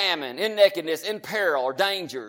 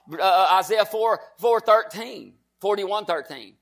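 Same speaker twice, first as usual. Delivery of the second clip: ordinary talk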